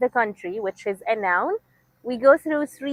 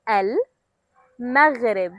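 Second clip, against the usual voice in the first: slowly; much slower than usual. Shown as a demonstration. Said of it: "One more time".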